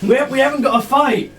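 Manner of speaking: captain calling off his men. Acted. Charmed captain telling his friends not to fight. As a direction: wave your boys down.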